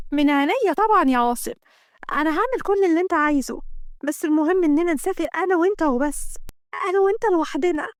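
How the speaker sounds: excited heroine, moderately played; eager woman in love. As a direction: agree happily, insisting on just the two of you.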